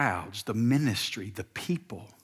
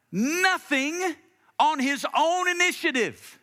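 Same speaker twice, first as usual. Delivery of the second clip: higher, climbing sermon voice; preaching at full force; 6 semitones higher than usual. Acted. Preacher building to a climax before church planters, fervent. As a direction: drive the point home to the congregation.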